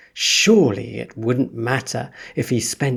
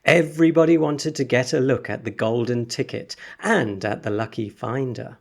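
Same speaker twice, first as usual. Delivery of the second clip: usual speaking voice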